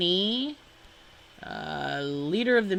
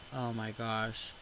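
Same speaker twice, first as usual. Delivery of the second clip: deflated oh my gosh; 7 semitones lower, 12 dB quieter than usual. Real, not acted. Overwhelmed by the list.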